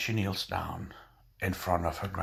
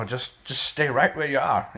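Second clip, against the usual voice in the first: bossy command; 7 semitones higher, 5 dB louder than usual. Acted. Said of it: "he says".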